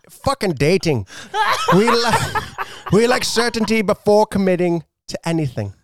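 high Scottish accent cracking into laughter. Shown as a demonstration. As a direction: push through the giggles in hammed-up Scottish. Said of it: "can you read this in a Scottish accent".

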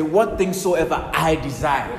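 loud, slow lead line to repeat; leading a response. Shown as a demonstration. Preacher leading the congregation in a spoken response, slow. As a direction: give it slow and loud for the echo.

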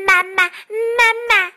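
high, loud duckling cry; duckling calling out. A narrator's reading. Newly hatched duckling calls to the fox, high and loud. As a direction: call for mummy in a high little duckling voice. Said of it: "叫着".

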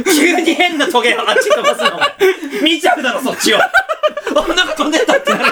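high-pitched, helpless laughing complaint; laughing protest. Real, not acted. Telling a colleague off for distracting them, laughing protest. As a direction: protest through real laughter.

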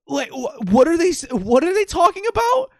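high, loud, rising incredulous what; jaw-dropped disbelief. Retold or imitated. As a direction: squeal it out in stunned disbelief. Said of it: "jaw dropped".

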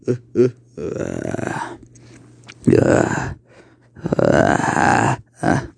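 deep, slow eerie chuckle; slow sinister demon laughing. Acted.

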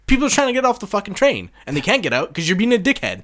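high, loud exasperated yell; loud indignant commuter rant; angry rant. Acted. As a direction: rant at the blocker. Ranting at rude commuters.

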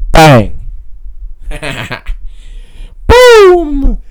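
explosive shouted hype interjections; Jason Genova. Acted. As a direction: blast out each word, very loud. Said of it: "Jason Genova style".

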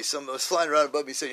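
ordinary delivery

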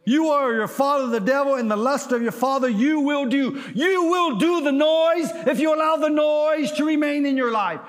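high, loud, driving sermon climax; preach. Acted. Preacher warning the congregation about noise, full-force preaching. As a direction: hammer the warning home at full voice.

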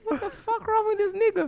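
high-pitched incredulous squeak; strained, high mock disbelief; mock bewildered reaction. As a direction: imitate a stunned customer, voice shooting up. Voices the bewildered customer's look.

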